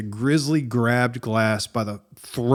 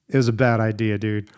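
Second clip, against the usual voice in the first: ordinary talk, nothing marked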